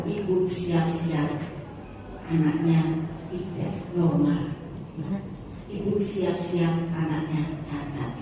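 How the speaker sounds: low, slow delivery of bad news; grave doctor. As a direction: break it slowly, phrase by phrase.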